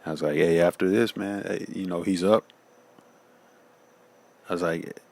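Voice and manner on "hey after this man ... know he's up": relieved excitement. Retold or imitated. Relief, father revived.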